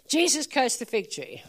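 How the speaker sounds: ordinary delivery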